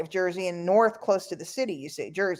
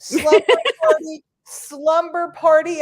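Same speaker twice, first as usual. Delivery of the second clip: high, loud giddy cry; giddy excitement; 6 semitones higher, 5 dB louder than usual. Real, not acted. Thrilled that her friend was no angel, giddy excitement. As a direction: squeal the plan with glee.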